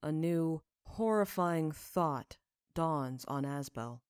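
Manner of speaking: plain reading voice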